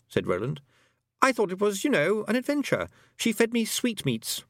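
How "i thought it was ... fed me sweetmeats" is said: put-on high-pitched boy's voice; boy character, wistful. A narrator's reading. Roland recalling his adventure with the Queen, high boy.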